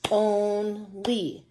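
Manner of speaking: "Only" split into two drawn-out beats; clapped-out syllables. Shown as a demonstration. Teacher clapping out syllables with pupils, slow split "Only". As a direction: hold each syllable of "Only" evenly, OHN, LEE. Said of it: "One more time".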